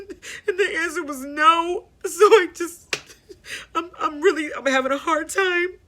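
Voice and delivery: crying through the words, half laughing; real crying. Real, not acted. Breaks down recalling father's death.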